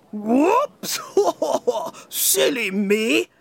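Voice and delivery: high whoops then a giggle; a silly king catching his own mistake; laughing at himself. Acted. King Jolly laughs at forgetting his bucket.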